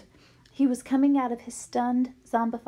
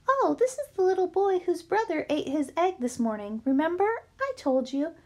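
higher, chatty lunch lady; 7 semitones higher than usual. A narrator's reading. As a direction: play a friendly lunch lady gossiping. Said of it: "smiled and said".

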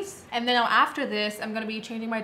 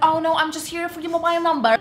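higher, louder smooth-talking guy; sleazy flirt fishing for a number; cheesy pickup line; 6 semitones higher, 6 dB louder, faster than usual. Retold or imitated. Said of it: "He's like".

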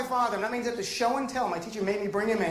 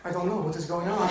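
put-on Palestinian accent; 4 semitones lower than usual. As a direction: lower Palestinian-accented English, imitating an immigrant dad. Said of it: "my dad has an accent".

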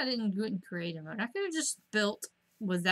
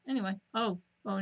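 ordinary talk

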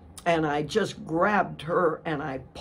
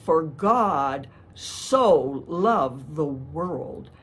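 slow quoted verse; much slower than usual. Shown as a demonstration. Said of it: "John three sixteen".

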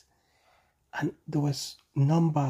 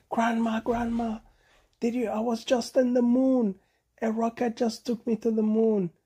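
high, eager monkey voice; breathless excited child; 7 semitones higher than usual. A narrator's reading. Bonobo telling Grandma about the moon, high and eager. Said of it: "ran into his grandma and said".

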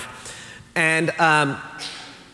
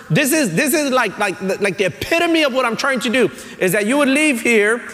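raised, driving sermon voice; preaching at full force; 10 semitones higher than usual. Acted. Pastor preaching to the church, raised and driving. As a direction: preach it out, building the point.

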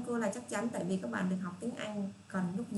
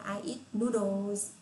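slow model answer; much slower than usual. Shown as a demonstration. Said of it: "các bạn nói là".

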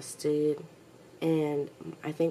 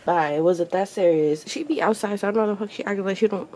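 scolding the dogs loudly; 2 semitones higher, 10 dB louder than usual. Real, not acted.

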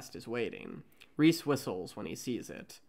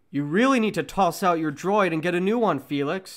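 loud, high put-on Valen voice; loud, bickering young mechanic; arguing; 6 semitones higher, 11 dB louder than usual. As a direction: snap at Felix in a quarrel. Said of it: "The two constantly argue".

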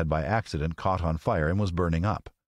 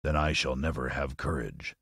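low, softer lion voice; storybook Lion; 4 semitones lower, 3 dB quieter than usual. Acted. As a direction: declare it low as a discouraged Lion. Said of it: "declared the Lion".

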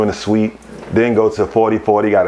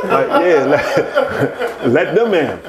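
laughing; 5 semitones higher, much slower than usual. Real, not acted. Pointing at strangers, laughing.

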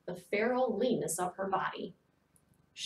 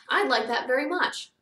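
put-on higher, louder eager boy; pleased, raised voice; eager reply; 5 semitones higher, 6 dB louder than usual. A narrator's reading. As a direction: call it back keenly. Boy calls reply to gentleman.

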